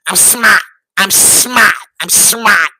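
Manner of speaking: I'm smart in a clipped-r mock Boston accent. Vlogger showing off his mock Boston accent, repeating it. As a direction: drop the r in smart, Boston style. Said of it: "That's my Boston accent".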